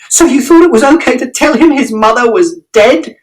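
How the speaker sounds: very high, accusing yell; furious shout. A narrator's reading. Mother shouting at father about the lie. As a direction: throw his lie in his face. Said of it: "And Mother shouted".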